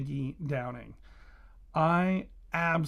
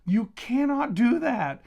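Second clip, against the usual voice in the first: loud, high, slow disbelieving protest; shocked protest; 5 semitones higher, 8 dB louder, slower than usual. Retold or imitated. Gasping at the book's ending, loudly protesting in mock shock. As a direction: plead at the book as if the author betrayed you. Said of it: "I literally gasped out loud near the end of it, and I said".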